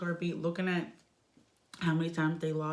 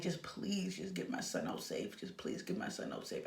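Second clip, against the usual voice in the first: hushed, much lower, whispered plea; pleading whisper; 9 semitones lower, 10 dB quieter than usual. Retold or imitated. Mother begging the doctor to save her son, whispered plea. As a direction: beg the doctor under your breath. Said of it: "I'm looking at him like".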